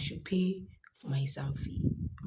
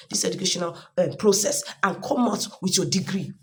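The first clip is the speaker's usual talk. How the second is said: loud, high motivational push to mothers; rally the listeners, build it up; rousing appeal; 4 semitones higher, 7 dB louder than usual. Real, not acted. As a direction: passionate pep talk to mothers. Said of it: "I'm saying this to mothers there".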